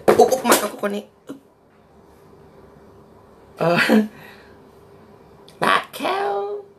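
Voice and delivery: surprised squeal. Real, not acted. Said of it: "surprised at the author".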